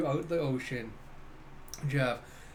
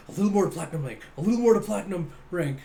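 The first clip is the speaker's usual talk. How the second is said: mildly urgent, louder gamer mutterings; eager gamer; 3 semitones higher, 6 dB louder, faster than usual. Acted. Neurotic captain tapping away at his game, mildly excited. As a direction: egg the game on for one more rank. Voicing gamer absorbed in play.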